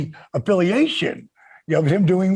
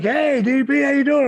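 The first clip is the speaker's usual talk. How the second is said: higher-pitched imitation of a friendly hello; retold upbeat hey, pitched up; imitated greeting; 8 semitones higher than usual. Randy greeting his wrestler friend at TV, imitated upbeat hello.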